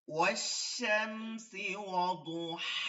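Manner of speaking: slow chanted والشمس وضحاها; Quran recitation. Shown as a demonstration. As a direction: recite the ayah with the long ha. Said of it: "কোরআনের মাঝে আয়াত দিয়ে পড়ি". (ধীরে কোরআন তিলাওয়াত)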